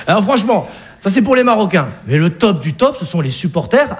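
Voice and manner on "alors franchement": ordinary delivery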